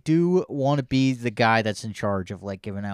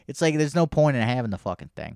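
usual speaking voice

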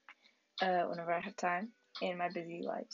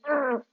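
ugh; 5 semitones higher, 8 dB louder than usual. Real, not acted. Annoyed about missing McFarland.

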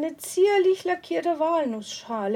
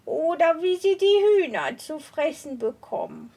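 higher, odd witchy voice; witch voice; 4 semitones higher than usual. Acted. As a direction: speak like a sly old witch. Witch advising the woman.

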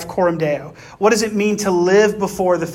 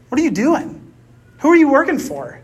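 higher-pitched, incredulous mock challenge; retold scolding; 6 semitones higher than usual. Brother confronting him outside the bathroom, retold scolding. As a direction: take him to task, pitched up, as a retold rebuke. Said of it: "just kinda jumped all over me".

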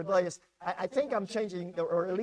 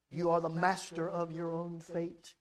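usual speaking voice

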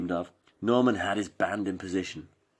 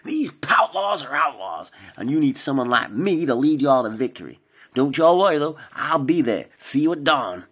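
higher, louder fake American cowboy accent; 6 semitones higher, 5 dB louder than usual. A narrator's reading. Norman holds cowboy voice.

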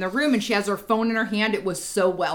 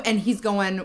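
ordinary talk

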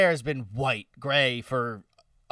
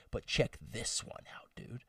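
whisper; 3 semitones lower, 14 dB quieter than usual. Retold or imitated. Dad sneaking hair gel in.

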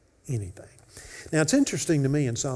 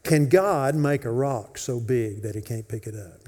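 usual speaking voice

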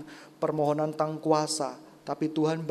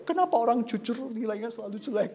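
retold complaint; 4 semitones higher, faster than usual. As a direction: complain to God in playful outrage. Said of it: "saya pernah marah sama Tuhan".

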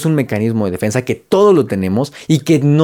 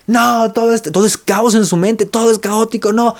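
high frantic inner voice, pitched way up; screaming inner voice; 8 semitones higher than usual. Retold or imitated. The inner parrot yelling chaos in a person's mind, high mock frenzy. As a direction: play the chattering parrot in the head, shrill and rushed. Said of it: "vocecitas interiores que gritan".

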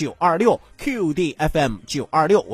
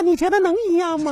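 squeezed, much higher voice; pinched throat voice; 11 semitones higher than usual. Shown as a demonstration. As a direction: pinch your throat and talk. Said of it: "捏着嗓子说话".

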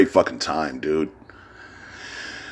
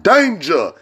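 high hype chant of Danger; hype call; 9 semitones higher than usual. Retold or imitated. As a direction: shout it like an announcer's catchphrase. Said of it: "Remember that shit?".

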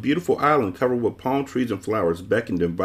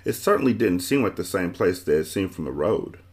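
ordinary delivery, nothing marked

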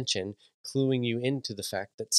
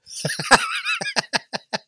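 sudden high-pitched burst of laughter; a loud, shocked laugh that breaks out; 5 dB louder than usual. Real, not acted. Dad cracking up at his son's morbid line, loud high laugh.

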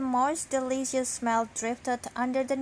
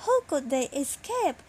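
plain reading voice

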